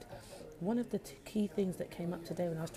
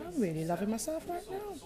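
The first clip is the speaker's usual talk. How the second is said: excited; 12 semitones higher, 5 dB louder than usual. Real, not acted. Gushing about self-love.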